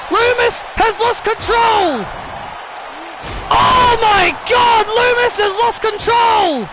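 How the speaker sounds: high, shouted wrestling commentary; frantic play-by-play. Acted. Commentator watching Lumis lose control, frantic play-by-play. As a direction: build from alarm to a drawn-out, horrified shout. Calls chair attack at full force.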